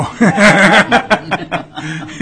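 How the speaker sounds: loud, high burst of laughter; real belly laugh at one's own joke; hearty laugh. Real, not acted. Chair joking with the nominee.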